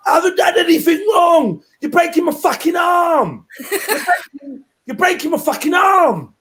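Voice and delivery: shouting in pain, being arrested; Tommy Robinson impression. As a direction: yell it like he's being held down. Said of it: "that's my Tommy impression".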